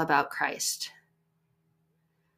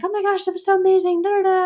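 high, loud, rushed gush; gushing excitement; 13 semitones higher, 7 dB louder, faster than usual. Retold or imitated. Wife gushing to husband on the drive home, high excited rush. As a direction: gush it fast, high and loud, reliving the moment. Retelling her excited phone call.

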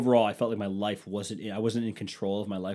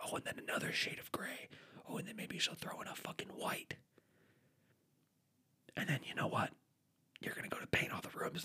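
much softer whisper, pretend aside; whispered tip; 2 semitones higher, 11 dB quieter than usual. Husband secretly warning another husband at Home Depot, whispering. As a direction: lean in and whisper to him.

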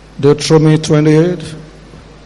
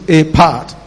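ordinary talk, nothing marked

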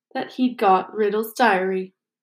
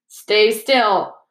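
grunted; 4 dB louder than usual. A narrator's reading. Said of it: "grunted the dwarf".

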